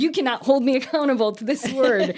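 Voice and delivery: high, loud refusal; frantic objection, played for laughs; panicked protest. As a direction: push back in comic alarm. Acted protest against God.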